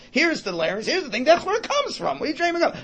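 high-pitched, exasperated protest; hammed-up disbelief; mock incredulous pupil. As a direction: argue back, pitch climbing as you go. Acted pupil arguing with teacher.

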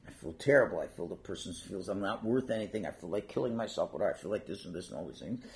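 low, soft, downcast recital; mock dejected child. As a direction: lightly act out a hurt child, low and subdued. Voices hurt child answering parent.

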